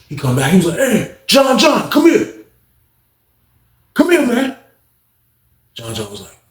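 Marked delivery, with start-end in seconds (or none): 0.8-4.6 high, slow drawn-out call; calling cellmate over. Retold or imitated. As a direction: stretch it out, summoning him from across the block. Inmate calls cellmate over.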